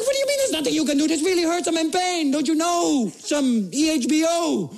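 high-pitched, frantic complaint; hammed-up outrage through pain; comic exasperated protest. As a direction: demand help in mock desperation.

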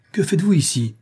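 high, indignant question; angry challenge. A narrator's reading. Caught murderer questions the armed workmen, indignant high voice. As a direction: demand an answer, pitch jumping up. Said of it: "gronda Limerick".

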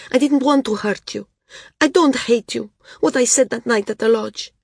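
put-on high, pleading young voice; blurted apology. A narrator's reading. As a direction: rush to explain, high and upset. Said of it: "Srella blurted".

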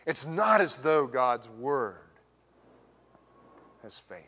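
slow, indignant stress on not, then fading; Paul's attitude. Shown as a demonstration. Preacher voicing Paul's retort, slow indignant emphasis. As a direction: give the verse Paul's indignant bite. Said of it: "Paul's got some attitude here".

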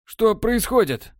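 high, slow bewildered question; bewildered cry. A narrator's reading. Borland realizing the betrayal, high slow bewildered. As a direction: ask it lost and reeling.

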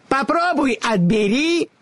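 put-on high, taunting old witch voice; mocking witch. A narrator's reading. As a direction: pull faces and taunt her. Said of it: "кривляясь, отвечала старуха".

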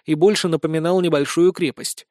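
usual speaking voice

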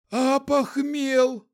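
high, slow pleading zombie voice; hopeful zombie. A narrator's reading. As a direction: whine it like a hungover zombie. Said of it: "в надежде спросил он".

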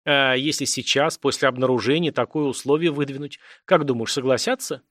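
plain reading voice

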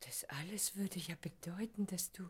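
stunned, whispered realisation; breathless whisper. Acted. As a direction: barely voice the dawning thought. Stunned realisation of his love.

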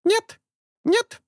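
high loud squawking bird; frightened parrot denial. A narrator's reading. Bird denies. (птичий испуганный крик)

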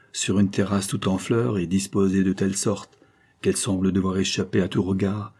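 ordinary delivery

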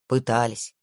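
low grim calm voice. Acted. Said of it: "с мрачным спокойствием подтвердил".

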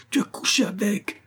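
high-pitched, strained scream at contained volume; screamed accusation. A narrator's reading. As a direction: shriek it like a madwoman, held back in volume. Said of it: "Elle hurla". (accusation hurlée, aiguë)

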